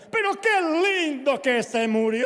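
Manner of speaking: loud, high, slow mock-cheerful exclamation; cheerful pastor. Pastor Ortiz cheers a grieving family at a funeral, loud and high. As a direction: barge into the wake beaming. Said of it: "siempre entraba y decía".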